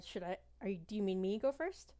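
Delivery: ordinary talk, nothing marked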